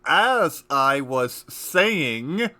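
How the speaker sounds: very slow and loud, drawn out; slow, pointed resumption. Acted. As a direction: stretch each word with mock patience. Narrator resumes after interruption.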